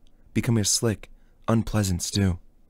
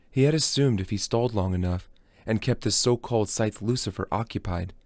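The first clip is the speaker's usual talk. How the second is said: ordinary delivery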